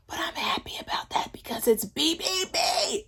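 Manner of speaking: whispered confession; conspiratorial whisper to viewers. Real, not acted. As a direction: drop to a hushed whisper. Secret aside to viewers.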